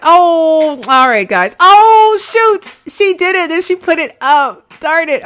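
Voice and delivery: high, loud flustered "oh shoot"; flustered self-quote. Retold or imitated. Retelling her own flustered reaction to the sparkler, high and loud. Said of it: "all after the fact is like".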